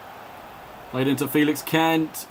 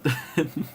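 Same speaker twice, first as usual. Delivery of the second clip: brief breathy chuckle; a quick, quiet laugh to himself; short laugh; 4 dB quieter than usual. Real, not acted. Commentator amused during the match, short chuckle.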